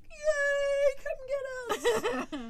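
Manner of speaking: hammed-up shout for help; mock rescue yell. Pretending to flag down rescuers at apocalypse, mock yell.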